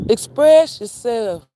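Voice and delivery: "Express yourself" slow, high and loud, EXPRESS hit hard; emphatic loud repeat. Shown as a demonstration. Urging followers to speak up, slow loud emphasis.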